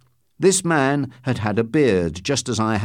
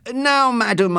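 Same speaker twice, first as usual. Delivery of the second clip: raised, much higher-pitched address; loud, professorial; 11 semitones higher than usual. A narrator's reading. Man acting the professor addresses the postmistress, loudly. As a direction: speak up like a self-important professor. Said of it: "I said loudly".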